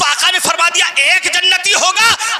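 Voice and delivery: high, driving pulpit oratory; fiery preaching. Real, not acted. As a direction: hammer out the list, then stretch the key phrases. Preaching at full force.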